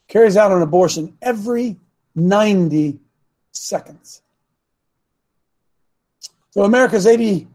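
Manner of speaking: ordinary delivery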